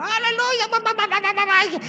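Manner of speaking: very high, loud shrieking babble; mock shouting women. Pastor mimicking Corinthian women shrieking in church, high and loud. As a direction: shriek like a noisy congregation.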